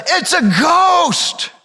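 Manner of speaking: slow, high frightened shout; frightened cry. Acted. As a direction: cry it out like a terrified sailor. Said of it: "One of them in the boat says".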